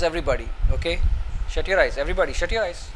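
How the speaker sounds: usual speaking voice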